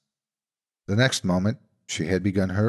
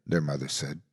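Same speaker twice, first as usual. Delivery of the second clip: ordinary delivery, nothing marked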